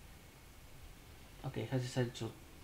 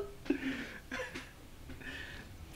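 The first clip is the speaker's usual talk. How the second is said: high breathy wheeze of laughter; real airy laughter; 13 semitones higher, 8 dB quieter than usual. Real, not acted. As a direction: laugh silently. Laughs after the roleplay line.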